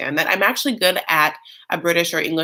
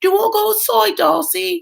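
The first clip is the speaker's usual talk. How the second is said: much higher mock sister voice; sister's voice; 12 semitones higher than usual. Sister talking to Darcy, high mock voice. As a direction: squeak it out like the tipsy sister. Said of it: "the sister's like".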